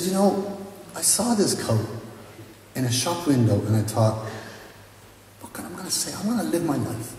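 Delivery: a hint of a lady remembering a decision; older lady's voice. Lady recalls buying the coat.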